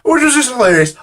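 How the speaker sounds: high, loud burst after laughing; real amusement. Real, not acted. Storyteller reacts to her own awkward story, amused. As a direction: blurt it out, tickled by the absurdity.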